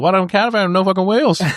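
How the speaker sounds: high, far-off traveller voice, raging; an outraged traveller over the wheels; mock Irish traveller. Friend quoting the caravan line, in a high mock Irish traveller voice. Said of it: "quoting Gorgeous George line".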